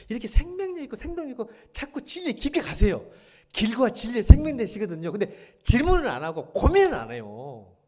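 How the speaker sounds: high, loud impassioned preaching; fervent pulpit shout; preaching at full force. Acted. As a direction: press it hard on the congregation.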